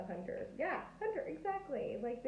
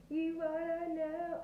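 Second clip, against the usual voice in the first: ordinary talk, nothing marked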